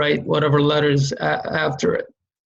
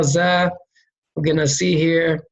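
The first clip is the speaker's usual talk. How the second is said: ordinary delivery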